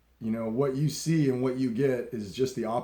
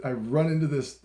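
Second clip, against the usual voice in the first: ordinary delivery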